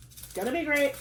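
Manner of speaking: cheering herself up after a sigh; bright reassurance. Real, not acted. Says it twice, differently.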